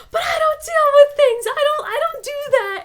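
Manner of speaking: high, loud insistent denial; indignant protest. Retold or imitated. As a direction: push back hard at the therapist. Retold protest to therapist.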